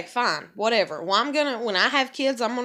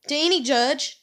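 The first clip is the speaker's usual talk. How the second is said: ordinary talk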